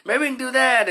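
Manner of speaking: high-pitched mock of classmates' suggestions; timid suggestion.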